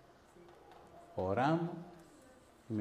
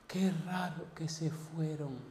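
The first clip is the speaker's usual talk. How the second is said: slow, soft, higher-pitched acted wonder; sighing relief; 5 semitones higher, 6 dB quieter, slower than usual. Woman to the preacher after the ordeal, softly sighing in wonder. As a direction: let it out gently after a sigh. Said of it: "Suspiró y me dice".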